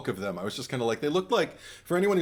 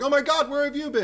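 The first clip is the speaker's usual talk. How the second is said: much higher, louder happy cry; thrilled welcome; 5 dB louder than usual. Retold or imitated. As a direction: greet a returning favourite with glee. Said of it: "and I was like".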